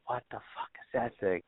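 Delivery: half-whispered amazement; retold quiet awe at the deer; hushed awe. Retold awe at huge buck.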